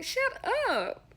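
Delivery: squeaky high teasing shut up; playful high brush-off. Retold or imitated. Brushing off the boss's daily apology, high and teasing. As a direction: wave him off way up high, playfully. Retold reply to his apology.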